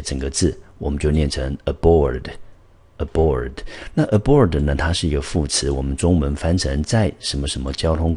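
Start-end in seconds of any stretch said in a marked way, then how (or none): 1.6-3.5 aboard said slowly with the weight on BOARD; slow teacher model of aboard, a-BOARD; stress on second syllable. Shown as a demonstration. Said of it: "这个字的重音节在第二个音节". (慢速示范aboard，重音在board)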